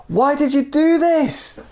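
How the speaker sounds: high, slow yelled question; desperate yell. Acted. Man yelling down to the dangling stranger, high desperate yell. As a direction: shout it down over the edge. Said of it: "looked over the edge and yelled".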